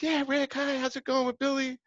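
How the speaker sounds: high, sweet voice of a cheerful mom; imitating the mother. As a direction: play his chirpy mother answering the phone. Mother Deborah voiced in story.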